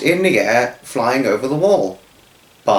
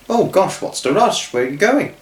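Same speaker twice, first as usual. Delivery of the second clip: surprised father; 7 semitones higher than usual. Acted. Father surprised coming home.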